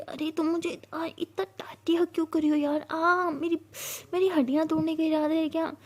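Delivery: squeezed, exasperated yelp of a friend; playful complaint. A narrator's reading. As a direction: protest a crushing hug, half in fun. Sia complains of too-tight hug.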